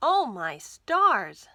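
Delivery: surprised exclamation. Acted. Martha shocked as the jumping beans escape. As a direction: gasp it out in shock.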